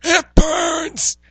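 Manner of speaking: very high, loud comic wail; mock agonized cry. Salesperson's flesh rotting at a touch, mock scream. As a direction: play it as hammed-up burning agony.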